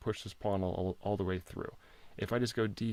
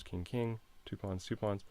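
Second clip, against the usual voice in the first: ordinary talk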